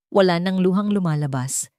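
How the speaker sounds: ordinary delivery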